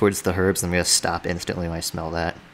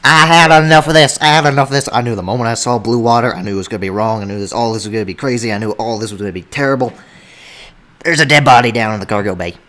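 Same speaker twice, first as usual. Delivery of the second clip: loud, frantic rant; panicked yelling; 4 semitones higher, 10 dB louder than usual. Acted. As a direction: lose it completely, shouting. Sherman panics after finding body.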